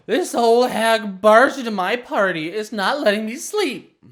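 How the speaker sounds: put-on high, loud drunken wail; top of her voice. Drunk girl complaining to her friend at the top of her voice. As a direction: yell it like a drunk child. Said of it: "at the top of her voice".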